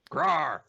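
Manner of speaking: loud mock monster roar; monster growl. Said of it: "you're gonna be Frankenstein".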